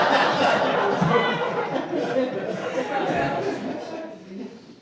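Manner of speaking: long breathy laugh, whispered; long real laugh, airy; breathy laughter. Real, not acted. Laughs after witch joke.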